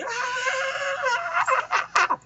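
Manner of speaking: high, far-off screaming cry; electrocuted scream. Retold or imitated. Villain fried by the battery again, screaming. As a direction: scream under the shock.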